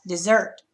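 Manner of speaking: dessert said dih-ZERT, end stressed; second-syllable stress. Shown as a demonstration. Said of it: "accent is gonna go over the second syllable".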